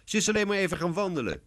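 put-on high, quick child's plea; pleading excuse. A narrator's reading. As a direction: hurriedly defend your sister. Lionel pleads for his sister.

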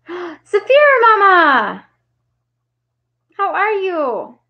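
high, drawn-out gleeful welcome; gasp, then sing out the hello, stretched long; delighted, high-pitched hello to a friend; excited greeting. Real, not acted. Greets a viewer joining the stream.

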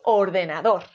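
"ordenador" stressed on DOR; or-de-na-DÓR; stress on last syllable. Shown as a demonstration. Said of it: "Y si es dor".

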